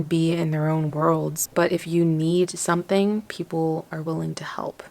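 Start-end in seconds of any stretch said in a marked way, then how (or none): 3.2-4.8 soft whispered reassurance; tired, gentle whisper. Real, not acted. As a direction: bedtime whisper of hope. Tired late-night reflection.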